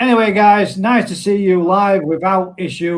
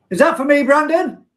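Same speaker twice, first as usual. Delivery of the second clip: raised, high call across the room; 10 semitones higher, 6 dB louder than usual. Real, not acted. Streamer calling out to his son about the pizza, raised and high.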